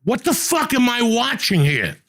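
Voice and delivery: high pitched disbelief at the TV; exasperated quote. Retold or imitated. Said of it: "you're sitting there going".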